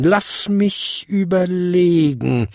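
slow, high, drawn-out musing; musing old lady, slow and high; thoughtful. A narrator's reading. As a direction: stretch the words while pondering. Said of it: "fuhr sie nachdenklich fort".

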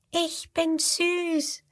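high, soft and slow squeak; squeaky little crocodile. Acted. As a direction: squeak it out like a tiny baby croc. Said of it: "quiekt das fünfte".

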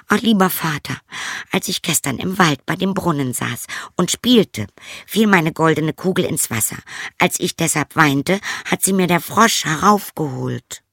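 high-pitched princess voice; high, pleading young princess; princess confessing. A narrator's reading. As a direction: explain anxiously to father, pitched high. Princess explains to her father.

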